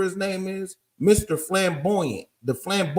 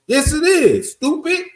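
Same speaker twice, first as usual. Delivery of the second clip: much higher, louder indignant comeback; exasperated retort; 9 semitones higher, 6 dB louder than usual. Acted. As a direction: fire back a high-pitched, scornful correction. Mock retort to a quoted claim.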